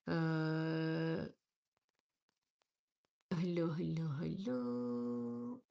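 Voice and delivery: normal talking voice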